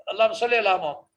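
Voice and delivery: اللہم صلی علی محمد gabbled quickly; rushed recitation. Shown as a demonstration. Teacher showing how not to recite, rushed. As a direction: rattle it off too fast. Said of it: "اس طرح نہیں پڑھنا تیزی سے".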